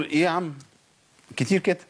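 ordinary talk, nothing marked

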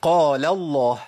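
قال الله with a thick, dark L in الله; deliberate 'qaala-LLAAH', L heavy; heavy lam. Shown as a demonstration. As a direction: make the L of الله full and velarized. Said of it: "اما اقول مثلًا".

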